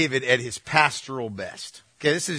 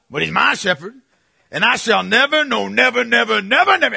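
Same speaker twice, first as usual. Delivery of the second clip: higher and louder, "never" piled on and climbing; mock preacher build-up; 6 semitones higher, 5 dB louder than usual. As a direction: crank each never higher like a revival preacher. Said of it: "this is how he did it".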